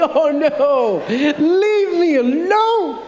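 high, drawn-out mock-panicked wail; hammed-up cry to get away; freaking out. Associate pastor grabbed by the trousers, hammed-up freaking out. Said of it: "I'm freaking out".

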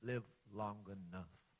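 soft, low intimate appeal; quiet aside. Acted. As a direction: draw them in close.

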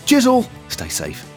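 ordinary talk

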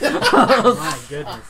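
slow high laughing. Real, not acted. Laughing at two nat twenties.

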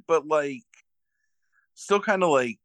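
ordinary talk, nothing marked

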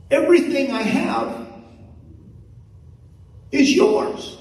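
high, building, ringing sermon climax; preaching at full force. Acted. Pastor tells the congregation everything is theirs, full preaching. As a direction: rise and proclaim it to the whole room.

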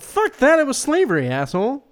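loud, high, angry Philadelphia yell; Philly accent. Retold or imitated. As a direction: shout it like a cursing Philly local. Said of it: "Was that your Philly accent?".

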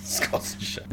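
whispering the answer, voiceless; whispered. Real, not acted. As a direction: breathe it out as a whisper. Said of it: "whispered repeat of the answer".

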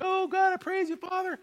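high-pitched cry of praise; imitated fervent praise. Healed woman giving God the glory, imitated fervent praise. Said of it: "giving God all the glory".

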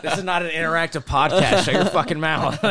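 high, loud, laughing telling-off; laughing mock yell. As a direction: yell it while cracking up. Mock scolding co-host, laughing.

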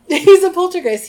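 laughing. Real, not acted. Host laughing about Peeves, repeating it. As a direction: laugh out He's a poltergeist.

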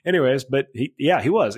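ordinary talk, nothing marked

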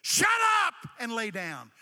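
loud, blunt command; stern rebuke. Acted. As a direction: shut the storm down hard. Jesus rebuking the raging wind.

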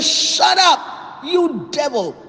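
high-pitched rebuke, acted out; mock scream of command; retold scream. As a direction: act out screaming at an evil spirit. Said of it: "he screamed out that evil spirit".

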